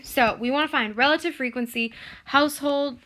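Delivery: normal talking voice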